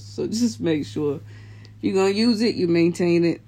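ordinary delivery

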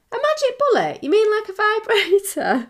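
high, eager blurt; high-pitched mum jumping to conclusions; surprised mum. Retold or imitated. Mum blurts answer to son.

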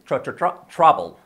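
"Tro-, tro-, tro-" repeated, then a loud full "trouble"; "trouble" built up from its repeated opening sound; pronunciation drill. Shown as a demonstration. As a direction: drill the first syllable "tro" before saying "trouble". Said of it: "la pronunciación también".

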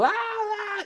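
high mock yell, a tantrum cry; imitated yelling. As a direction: shriek like the boyfriend did. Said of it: "Pero así como".

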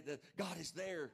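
ordinary delivery

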